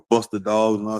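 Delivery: normal talking voice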